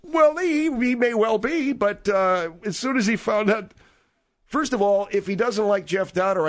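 a second character pitched well up. Acted. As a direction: talk up high as the other caller.

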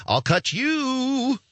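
high, very slow mock menace; tough guy threat. Street guy threatens you, slow hammed-up menace. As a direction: drag the threat out slowly. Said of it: "some guy saying to you".

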